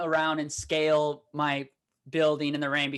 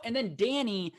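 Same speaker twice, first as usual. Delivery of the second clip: usual speaking voice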